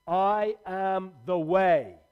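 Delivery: slow, loud, each word weighed; a stranger slowly proclaiming in a shop; slow proclamation. Retold or imitated. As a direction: declare it slowly like a stranger calling out. Stranger speaks out in Co-op.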